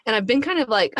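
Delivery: ordinary talk, nothing marked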